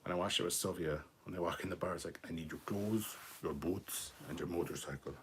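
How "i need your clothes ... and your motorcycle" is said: a weak try at an Austrian Arnie growl; barely-there Arnie Schwarzenegger voice, softer; failed Arnie accent. Retold or imitated. Reading a fan comment, attempting a feeble Arnie accent. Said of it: "I can't do an Arnie accent".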